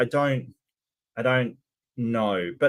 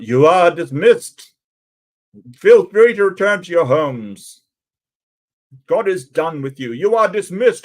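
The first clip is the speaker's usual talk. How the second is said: mock pastor dismissal; 3 semitones higher, 9 dB louder than usual. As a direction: ham it up like a pompous pastor sending everyone home. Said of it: "is that what they say? You dismiss, like".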